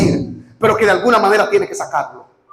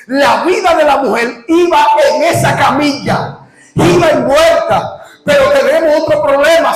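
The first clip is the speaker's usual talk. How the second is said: full-force preaching; 6 semitones higher than usual. Acted. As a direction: preach it out to the crowd. Predica a toda voz. (predicación a toda voz)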